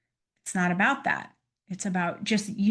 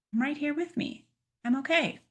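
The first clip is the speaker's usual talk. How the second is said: soothing self-talk; 7 semitones higher than usual. Shown as a demonstration. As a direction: gently tell yourself you're okay. Demo of comforting self-talk.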